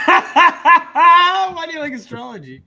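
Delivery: high, loud laughing question, drawn out; laughing disbelief. Real, not acted. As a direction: tease through a laugh, incredulous. Mocking amused reaction to the admission.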